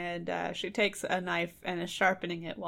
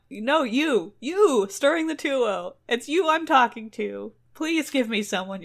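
loud, higher accusing shout; dodo shouting; 4 semitones higher, 7 dB louder than usual. Retold or imitated. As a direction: yell at the stirrer to get attention. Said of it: "the man goes on".

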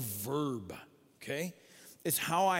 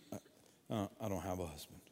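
sheepish mumble; 8 semitones lower, 9 dB quieter, faster than usual. Retold or imitated. Embarrassed woman answering.

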